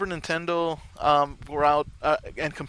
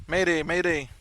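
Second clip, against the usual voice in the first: mock mayday call; 6 semitones higher than usual. Mock radio distress call, lost contact.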